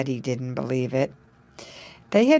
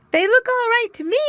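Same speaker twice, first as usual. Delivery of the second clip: otter voice; 4 dB louder, faster than usual. A narrator's reading. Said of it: "said he".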